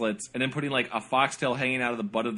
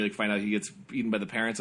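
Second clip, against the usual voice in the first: normal talking voice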